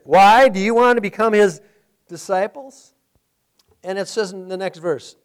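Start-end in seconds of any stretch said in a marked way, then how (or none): 0.1-2.9 sarcastic. Retold or imitated. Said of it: "think of that verse".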